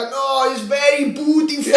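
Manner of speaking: high, slow seller's patter; mock shop seller. Chinese souvenir seller coaxing a tourist, high slow mock sales pitch. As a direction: imitate a seller talking up the goods. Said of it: "And he'd be like".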